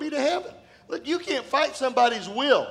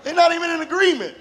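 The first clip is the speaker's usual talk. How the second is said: louder and higher through laughter; laughing; 7 semitones higher, 3 dB louder than usual. Real, not acted. Preacher laughing at the couple's mismatch, higher and louder. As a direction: laugh out the punchline. Punchline of funny story.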